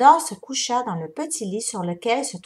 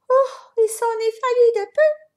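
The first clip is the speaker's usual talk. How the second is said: high, louder, out of breath; girl panting after her escape; 13 semitones higher, 8 dB louder than usual. A narrator's reading. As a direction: gasp it after running. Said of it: "dit-elle en haletant".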